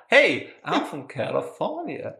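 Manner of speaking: slow, lightly put-on Californian accent. [name] introducing himself on the podcast, mild Californian accent. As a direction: drawl out the hello like a laid-back American. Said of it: "We say it differently".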